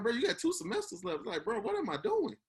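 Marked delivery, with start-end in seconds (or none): 0.0-1.2 high-pitched mock alarm at how little is left; retold incredulous alarm. Graduate retelling news of few semesters left, incredulous alarm. 1.4-2.4 squeaky-high mock panic at himself; retold panic. Graduate recalling his realization near the end, mock panic.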